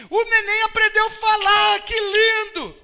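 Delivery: loud, high-pitched doting parent; gushing delight. Acted. Parent announcing the baby has learned to talk, gushing. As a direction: gush proudly over the baby.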